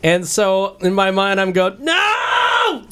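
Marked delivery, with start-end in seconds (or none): 1.8-2.8 high, drawn-out mock scream of dismay; hammed-up "nooo" shout; acted anguished cry. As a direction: wail it like a movie hero's despair. Acting out inner cry at theft.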